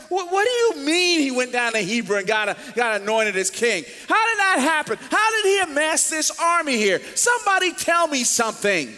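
loud, high, exasperated demands; exasperated David. Retold or imitated. David to his trusted cronies, loud and exasperated. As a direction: shout at the men who failed him. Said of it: "He said".

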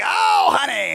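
high, booming call to her husband; loud older wife. Retold or imitated. Older wife meaning only her husband to hear, far too loud. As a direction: imitate an old wife who talks far too loud. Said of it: "they talk loud enough for people within a three hundred meter radius".

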